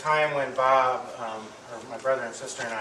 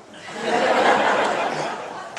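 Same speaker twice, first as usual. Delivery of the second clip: a loud breathy laugh; amused laugh; 9 dB louder than usual. Real, not acted. Eulogist laughing at the brothers' teasing, loud laugh. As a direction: let a real laugh out.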